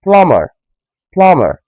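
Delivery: Plumber said plah-mer, B silent; Plumber, open ah, no B. Shown as a demonstration. Teacher modelling Plumber, open ah, silent B. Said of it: "the B silent".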